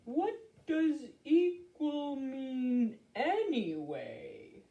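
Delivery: slow, puzzled turtle drawl. Acted. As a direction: ask it at a turtle's pace. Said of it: "said Turtle".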